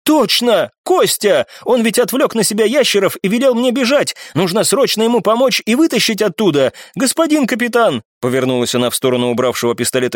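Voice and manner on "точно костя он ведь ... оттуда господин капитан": high, urgent exclamation; crying out. A narrator's reading. Brunette remembering Kostya needs rescue, urgent cry. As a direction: burst in with alarm. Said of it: "перебила вскрикнувшая брюнетка".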